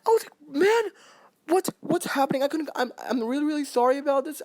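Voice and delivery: can't calm down yet, still up high; still worked up. Real, not acted. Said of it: "control my feelings".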